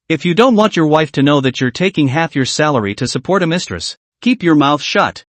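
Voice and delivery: usual speaking voice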